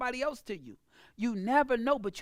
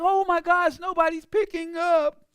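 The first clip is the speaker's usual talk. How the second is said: whiny complaining; 6 semitones higher, 8 dB louder than usual. Retold or imitated. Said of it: "you might be like".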